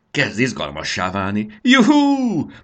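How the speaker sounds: rising to a high, loud whoop; whooping with joy; jubilant cheering. A narrator's reading. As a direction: cheer with mounting glee, ending in a big "juhú". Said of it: "újjongott Marci".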